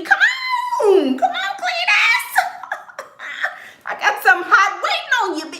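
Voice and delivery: high-pitched taunting yell with cackles; taunting the abuser. Acted. Wife on the porch daring her husband, taunting shout. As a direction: dare him to come, laughing at him.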